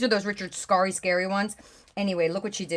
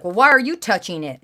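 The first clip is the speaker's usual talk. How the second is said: put-on louder, cheeky little-girl talk-back; sassy kid imitation; 8 dB louder than usual. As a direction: mimic a sassy kindergartner. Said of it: "She said it just like that".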